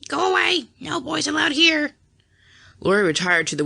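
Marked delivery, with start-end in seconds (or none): 0.1-1.9 parrot squawk. A narrator's reading. Parrot calling out at the visitors, squawking high. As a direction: screech it out like a parrot. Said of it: "the parrot ... called out".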